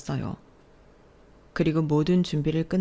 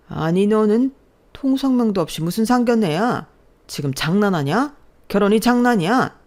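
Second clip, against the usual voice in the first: loud, higher furious father; angry shout; 6 semitones higher, 6 dB louder than usual. Acted. Father yelling at his daughter over the surprise wedding, furious shout. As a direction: bark at the daughter in outrage.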